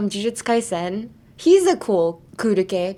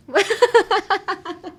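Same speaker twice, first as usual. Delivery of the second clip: high-pitched burst of laughter; real laughing fit; 10 semitones higher, 3 dB louder than usual. Real, not acted. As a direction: crack up over failed attempts. Cracks up over pronunciation practice.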